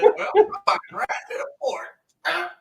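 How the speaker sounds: mock secretive whisper; whispered aside. Acting out own fork habit.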